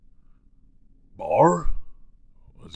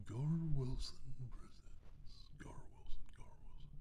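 whispered trailer voice; 5 semitones higher, 12 dB quieter than usual. Shown as a demonstration. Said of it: "my trailer guy voice".

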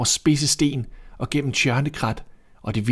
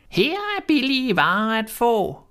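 put-on old peddler woman; 13 semitones higher than usual. A narrator's reading. Disguised queen calling at the door, high crone voice. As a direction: cry out the wares like an old hag. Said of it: "som en gammel sælgekone".

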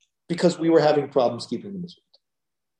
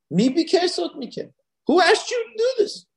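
very high-pitched indignant challenge; God, indignant; 13 semitones higher than usual. Acted. God challenging worshippers who come before him, indignant. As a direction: throw the question out high, affronted. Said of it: "That's what he says here".

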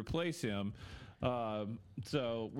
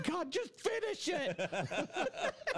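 screaming candy; 14 semitones higher, much slower than usual. Pretend. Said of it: "Or, like, Laffy Taffy. It's just".

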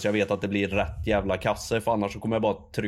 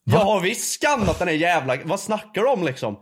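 indignant retort; 6 semitones higher than usual. Retold or imitated. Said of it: "Jag bara".

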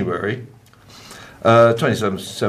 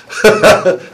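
short, higher, louder laugh; amused laugh; 8 semitones higher, 7 dB louder than usual. Real, not acted. Presenter amused by Christmas birth dates, amused laugh. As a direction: burst out in a quick real laugh.

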